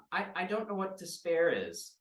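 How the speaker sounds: put-on puzzled child; little girl. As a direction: naive childlike question. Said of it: "and then Maisie goes".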